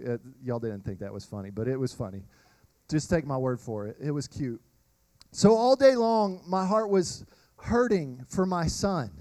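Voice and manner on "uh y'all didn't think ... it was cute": sheepish aside. Real, not acted. Aside to the congregation.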